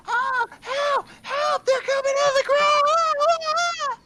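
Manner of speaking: very high, loud panicked shriek; young woman screaming. Acted. Young woman at the construction site screams for help, panicked shriek. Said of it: "you hear a young woman just".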